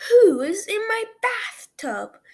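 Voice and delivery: loud high startled cry; startled shout. A narrator's reading. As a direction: yell it in shock from the tub. Startled bather cries out.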